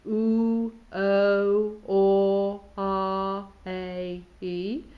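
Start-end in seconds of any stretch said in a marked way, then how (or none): 0.1-3.5 loud sustained vowels "oo", "o", "or", "ah" held long; vowel drill. Shown as a demonstration. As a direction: sing out each vowel "oo" "o" "or" "ah" evenly. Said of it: "something like".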